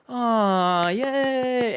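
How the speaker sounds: high cooing "aw, yay"; audience cooing. Retold or imitated. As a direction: coo like a delighted crowd. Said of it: "the audience goes".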